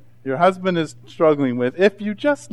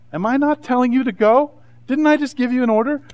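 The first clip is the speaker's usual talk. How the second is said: indignant challenge; 8 semitones higher than usual. Acted. God challenging hesitant Gideon, acted.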